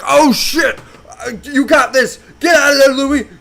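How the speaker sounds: high, loud, frantic shouting; panicked urging. Retold or imitated. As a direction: yell urgent encouragement at a struggling lifter. Retold panic helping trapped lifter.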